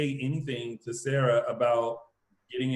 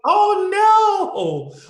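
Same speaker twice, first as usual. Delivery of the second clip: high, loud, drawn-out wail; loud theatrical reaction of dread; mock dismay; 9 dB louder than usual. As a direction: cry out in exaggerated dismay. Acts out her shocked reaction.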